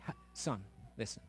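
ordinary delivery

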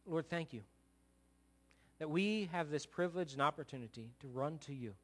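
earnest pastoral prayer; heartfelt closing prayer. Acted. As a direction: pray over the congregation. Leads a prayer.

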